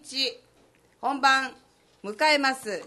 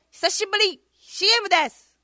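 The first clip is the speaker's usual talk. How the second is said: bright announcer call; 10 semitones higher, 6 dB louder than usual. Acted. Cheery call into the break.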